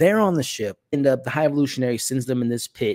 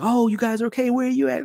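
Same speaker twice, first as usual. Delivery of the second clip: higher, mock excited relief; relieved Peter; 13 semitones higher than usual. Peter on the intercom to the crew, higher mock relieved call. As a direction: call out gladly to friends you thought dead. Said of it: "Peter is like".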